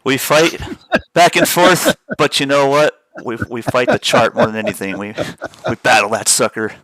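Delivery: excited. Real, not acted. Still laughing, energized reply.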